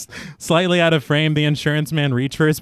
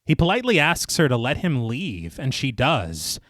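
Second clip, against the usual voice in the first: measured, lower narrator's read; story narration; 3 semitones lower, 3 dB quieter than usual. A narrator's reading. Narrator recaps the inquiry scene for listeners, measured storytelling. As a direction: read it like a polished audio storyteller. Reads scripted film recap.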